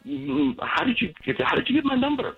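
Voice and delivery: who is calling me; wary spam call answer. Real, not acted.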